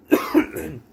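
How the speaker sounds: short sharp cough; hacking cough. Real, not acted. Coughing fit mid video.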